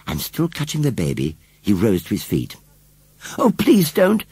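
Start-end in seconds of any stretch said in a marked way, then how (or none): 3.4-4.2 high, loud, urgent plea; pleading. A narrator's reading. Susan begs the scarecrow to stay, pleading. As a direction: beg him in a child's alarm.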